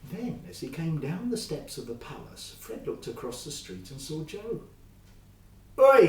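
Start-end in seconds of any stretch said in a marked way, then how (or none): 5.8-6.1 shout. A narrator's reading. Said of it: "he shouted".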